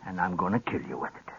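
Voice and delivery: low, hushed and quick death threat; a soft, deadly promise to the man who cheated him; quiet menace. Acted. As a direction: drop the voice and say it fast and cold. Old rancher threatens the lawyer.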